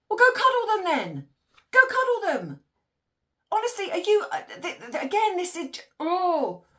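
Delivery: she's losing patience, let it out; ranty. Real, not acted. Ranting about the mum who wants cuddles, exasperated. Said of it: "I'm getting ranty".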